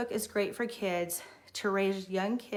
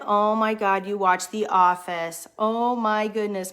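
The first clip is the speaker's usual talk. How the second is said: loud, slow, amazed exclaiming; 7 dB louder, slower than usual. Retold or imitated. Teacher marveling that a little boy watches The Office, loud and amazed. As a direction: let the disbelief spill out loud. Amazed reaction to child quoting show.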